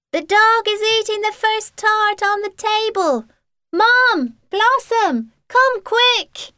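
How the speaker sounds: high panicked shout; alarmed call. Acted. Child alarmed calling out.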